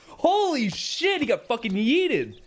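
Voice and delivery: high, slow shocked laugh. Real, not acted. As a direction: burst out, stunned and amused. Reacts to beetle kicking ants.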